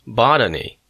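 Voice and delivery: botany stressed on its first syllable, BOT-a-ny. A narrator's reading. Clerk correcting the student, botany punched as BOT-a-ny. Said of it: "learn the right pronunciation of the word".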